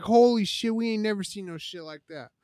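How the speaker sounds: high, loud amazed exclamation; imitating stunned guards; astonished guards. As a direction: gasp it out in disbelief. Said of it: "so they're like".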